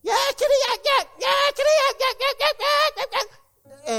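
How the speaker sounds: shrill high-pitched imp yammering, very loud; jabbering little devil. Retold or imitated. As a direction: screech nonsense to drown someone out. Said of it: "that devil got between him and Jesus and said".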